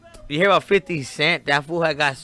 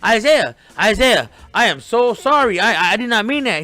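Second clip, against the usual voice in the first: higher, louder, frantic backpedalling; panicked apology; 6 semitones higher, 5 dB louder than usual. Retold or imitated. Retold principal apologizing frantically to the dad. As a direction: beg forgiveness in a flustered rush. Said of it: "he was like".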